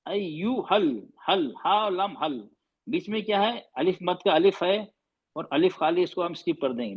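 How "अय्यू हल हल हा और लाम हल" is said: very slow, drawn out अय्यू हल, joining हा and लाम into हल; slow reading demo. Shown as a demonstration. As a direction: sound out हल slowly, letter by letter. (बहुत धीरे, हर्फ़ मिलाकर पढ़ना)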